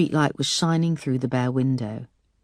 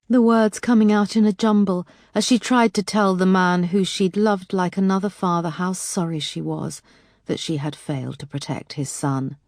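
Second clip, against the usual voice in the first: ordinary delivery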